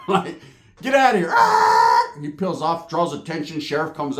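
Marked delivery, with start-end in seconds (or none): 0.8-1.3 high-pitched mock yell; acted shout. Acting out the confrontation's climax. 1.3-2.2 loud, rough mock scream; acted scream. Noise at climax of acted fight.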